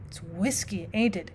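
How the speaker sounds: soft, feeble little pipe; piped feebly. A narrator's reading. Old clerk asks the boss, feebly and softly. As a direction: ask it weakly, barely voiced up. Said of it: "he piped feebly".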